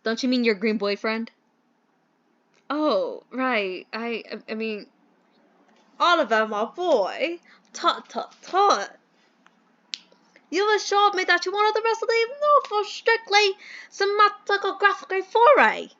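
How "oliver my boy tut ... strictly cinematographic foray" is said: bad British accent. Mentor chides his employee Oliver, hammed-up British accent. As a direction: scold like a stagey British gentleman. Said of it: "do the worst British accent".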